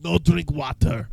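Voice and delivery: put-on slow, loud broken Russian accent; hammed-up Russian coach shouting slowly. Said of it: "in that Russian accent".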